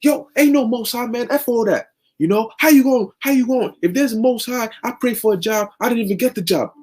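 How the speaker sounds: very high, cursing tirade; carnal rant. Retold or imitated. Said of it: "carnal-minded version who's just".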